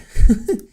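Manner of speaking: sudden high laugh; a bright burst of real laughter. Real, not acted. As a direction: laugh out, caught off guard. Laughing while finding excerpts.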